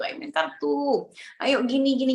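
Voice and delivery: put-on high, lilting invitation to a child; light sing-song urging; playful coaxing. Parents coax child with games.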